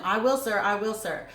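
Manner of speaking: ordinary talk, nothing marked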